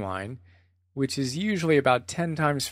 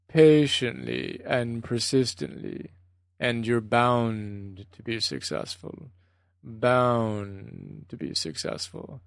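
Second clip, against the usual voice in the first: Goenka's very slow Burmese voice; 3 semitones lower, slower than usual. Retold or imitated. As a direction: stretch every word in a rhythmic Burmese accent. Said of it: "He'd say it so slowly".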